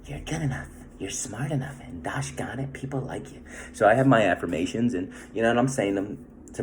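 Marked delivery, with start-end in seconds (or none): 0.0-3.4 soft, gentle quoting of a self-help affirmation; lightly voiced, soothing affirmation; Stuart Smalley affirmation. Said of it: "like, Stuart Smalley".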